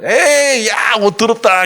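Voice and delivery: high jeering devil voice, teasing the priest; mocking devil. Devil teasing the high priest about his clothes, high and mocking. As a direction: sneer at the dirty clothes, pitched high. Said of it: "이렇게 놀리는 거예요".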